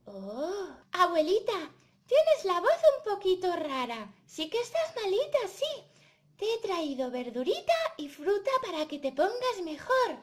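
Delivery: put-on high little-girl puppet voice; concerned Caperucita. Caperucita visiting her poorly grandma, concerned and high. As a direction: fuss over grandma in a high, lively voice.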